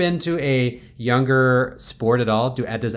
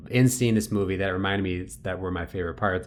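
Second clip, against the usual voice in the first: usual speaking voice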